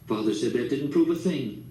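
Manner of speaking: usual speaking voice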